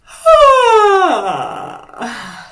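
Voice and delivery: voiced yawn sighing down from high and loud; loud yawn with sound. Shown as a demonstration. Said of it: "quickly yawn".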